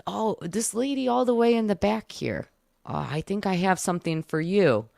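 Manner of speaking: a hint of a sweet elderly psychic; cute little old man. Old psychic calling a lady in the back, cute old-man voice. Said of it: "like a cute little old man".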